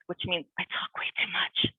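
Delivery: a quick breathy whisper, half to herself; whispered aside. Real, not acted. Whispering a confession about her own chatter. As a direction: confide it under your breath.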